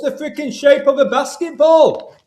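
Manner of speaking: high and loud, bursting with the reveal; shouting the riddle to his co-host in delight; excited. Real, not acted. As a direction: build up to the punchline, thrilled.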